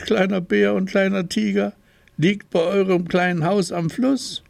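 curious little creature asking about the boat; questioning story voice. A narrator's reading.

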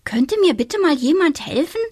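little witch fed up with the chickens; exasperated little witch. A narrator's reading. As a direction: beg impatiently like a stuck little witch. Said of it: "stuck witch pleads".

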